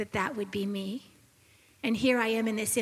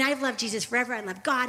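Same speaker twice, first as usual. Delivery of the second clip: usual speaking voice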